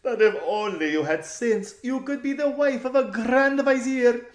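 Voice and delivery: high, loud, affected lady's voice; silly noble girl. Acted. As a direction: play the spoiled, scolding rich girl.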